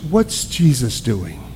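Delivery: whispered, slow question; hushed question. Retold or imitated. A counselor asks him in a hush what Jesus is doing. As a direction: lean in and ask it in a hushed voice.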